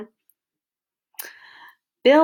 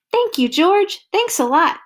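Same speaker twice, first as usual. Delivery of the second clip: higher, louder happy thanks; Bill thrilled to get his kite back; delighted thanks; 8 semitones higher, 5 dB louder than usual. Acted. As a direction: gush with relief and joy. Bill thanks George joyfully.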